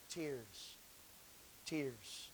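Tears. Tears. said very soft and low; hushed repetition. Real, not acted. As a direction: let tears fall to a near hush. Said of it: "quiet repeated tears".

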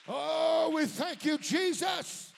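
loud, high shout of thanks; shouted praise. Real, not acted. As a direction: lift it up and shout to Jesus. Preacher praising at full force.

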